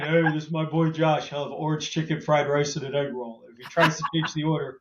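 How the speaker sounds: lightly voiced Shaq ordering for his friend; a hint of big-man swagger, playful; imitating Shaq. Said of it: "he's like".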